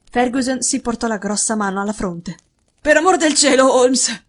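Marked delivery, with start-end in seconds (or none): 2.8-4.2 loud, higher, slightly hoarse plea; hoarse exclamation. A narrator's reading. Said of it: "esclamò con voce roca". (esclamazione con voce roca)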